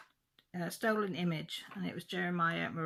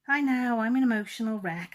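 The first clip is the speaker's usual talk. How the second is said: much louder and higher, overcome with feeling; loud emotional outburst; 4 semitones higher, 11 dB louder than usual. Real, not acted. To her viewers after opening the gift, a loud emotional cry. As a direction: blurt it out, choked up and loud. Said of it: "It's like a baby crying".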